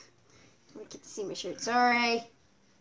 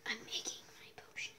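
whispering; 15 dB quieter than usual. Real, not acted.

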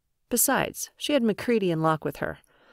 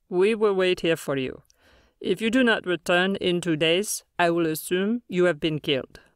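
measured, otherworldly alien elder; calm alien leader voice. Acted. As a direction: speak as a grave alien leader.

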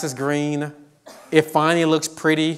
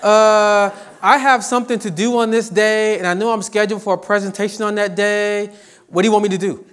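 higher, louder student voice with laughter; mock whiny student; 6 semitones higher, 5 dB louder than usual. Professor imitates students asking to miss class, mock whiny. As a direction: play a student angling to skip class, half laughing.

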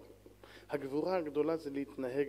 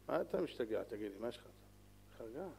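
very soft, low calming words; hushed calming; 6 semitones lower, 12 dB quieter than usual. Acted. Preacher quietly calming an enraged man, very soft. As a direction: hush him down quietly.

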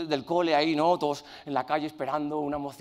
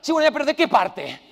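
defiant retort; 7 semitones higher, 7 dB louder than usual. Retold or imitated. Father retorting to English teacher.

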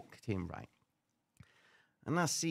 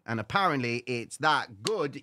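ordinary talk

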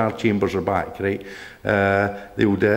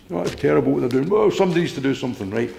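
higher, fast whiny kitchen moaning; mock whinging; 5 semitones higher, faster than usual. Mocking armchair complainers who whine in the kitchen, higher and fast. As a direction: parody a hand-wringing complainer, quick and whiny. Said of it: "sit and whinge and whine in the kitchen".

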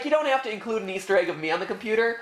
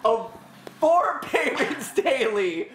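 slow sales pitch cracking up; laughing pitchman; 5 semitones higher, slower than usual. Pretend. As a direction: sell it while giggling. Mock infomercial breaking into laughs.

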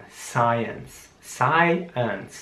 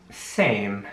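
"Same" said slowly and softer; "Same" slow, quiet and clear; 5 semitones lower, 4 dB quieter than usual. Shown as a demonstration. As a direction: stretch out "Same" for the class. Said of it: "Ich sag das Wort noch einmal langsam".

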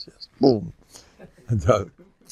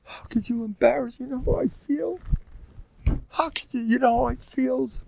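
whiny complaint; 10 semitones higher, 6 dB quieter than usual. Acted. Acting out a hurt complainer.

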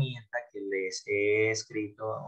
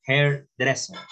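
"hairdresser" higher, with HAIR and DRESS picked out; stress on syllables shown; 4 semitones higher, 4 dB louder, slower than usual. Shown as a demonstration. Teacher shows students the accents, "hairdresser" stressed on early syllables. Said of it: "miren los acentos".

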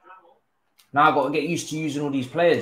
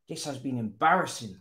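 normal talking voice

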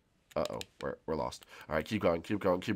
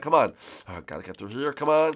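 ordinary talk

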